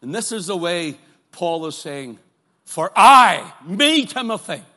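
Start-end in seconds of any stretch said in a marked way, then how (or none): 2.6-4.6 "I" punched out loud and hard, then me, Timothy; stress on I. Shown as a demonstration. Preacher showing Paul's emphatic "I", loud punch on "I". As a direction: hit the "I" like a hammer. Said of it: "It's an emphatic "I".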